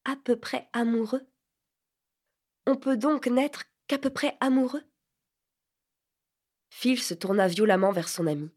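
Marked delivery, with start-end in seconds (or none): 0.1-4.8 questions hautes et retenues; étonnement blessé. A narrator's reading. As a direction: reprendre ses mots, incrédule. Vinca reprend, blessée. (doux étonnement blessé)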